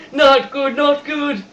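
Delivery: falling villain's mock panicked shout; panicked Russian guy. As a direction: yelp it as he falls. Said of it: "You can almost hear him saying".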